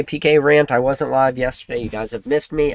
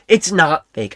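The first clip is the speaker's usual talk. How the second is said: ordinary talk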